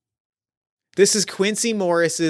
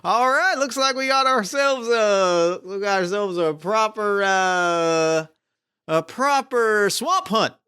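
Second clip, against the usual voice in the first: a whooping swamp hunter relishing the job; eager cowboy hunter; 5 semitones higher than usual. Acted. Plays cowboy hunter William Morris.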